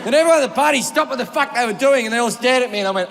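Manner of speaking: ordinary talk, nothing marked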